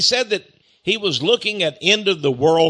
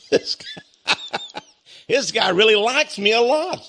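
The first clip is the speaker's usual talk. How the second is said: breaking into laughter, then higher pitched; amused laughter; 8 semitones higher than usual. Real, not acted. Man reacting to a critic's insults, laughing. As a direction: chuckle your way into the words.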